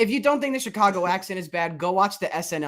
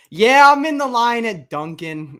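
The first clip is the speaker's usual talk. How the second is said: loud, high mock Boston accent; hammed-up Masshole line at Dunkin'; 6 semitones higher, 9 dB louder than usual. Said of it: "do terrible impressions".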